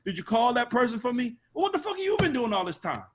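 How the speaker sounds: high-pitched mock indignation; mimicking entitled artist. Artist chasing the A&R about a call, mock indignant. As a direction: escalate from nagging question to put-on outrage.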